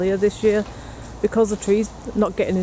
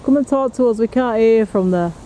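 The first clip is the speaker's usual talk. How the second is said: raised, higher call to someone at a distance; louder, higher beckoning call; calling over; 4 semitones higher, 5 dB louder than usual. Real, not acted. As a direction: call your companion over to join you.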